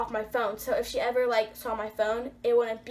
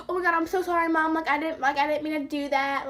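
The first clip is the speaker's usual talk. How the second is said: higher, pleading mock apology; sucking up; 4 semitones higher than usual. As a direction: gush a hurried apology to get out of trouble. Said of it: "trying to, like, suck up to her".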